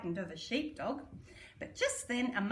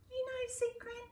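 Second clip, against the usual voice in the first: tender; 14 semitones higher, 5 dB quieter than usual. Acted. Grandma answers tenderly.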